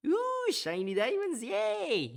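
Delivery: very high, loud mock squeal; giddy delight. Miner spotting shiny diamonds, hammed-up high squeal of delight. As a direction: squeal it like a hammed-up treasure find.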